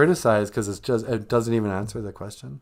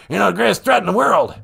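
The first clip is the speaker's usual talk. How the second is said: loud, higher blustering politician; Joe Biden; 7 semitones higher, 6 dB louder than usual. Acted. President rambling to the host about threats, blustering. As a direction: proclaim it with sudden force. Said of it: "Mister President".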